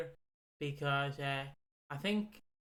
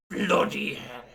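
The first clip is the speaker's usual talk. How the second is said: put-on loud grumbling old bloke; grumpy bigot; 3 semitones lower, 9 dB louder, much slower than usual. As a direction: bellow a cranky curse. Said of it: "People now just complain".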